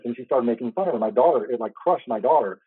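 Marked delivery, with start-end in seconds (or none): none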